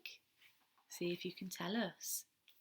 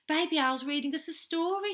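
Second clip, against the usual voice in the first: louder, eager call; excited shout; 7 semitones higher, 7 dB louder than usual. Acted. Tiny Chick tells the others, excited shouting. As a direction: shout the news proudly. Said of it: "shouted Tiny Chick".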